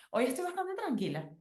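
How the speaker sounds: pitched well up, light and cheerful; high bright answer. Retold or imitated. Answering a friend who asks how she feels: high, light reply.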